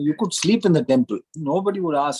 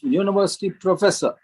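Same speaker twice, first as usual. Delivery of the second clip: ordinary delivery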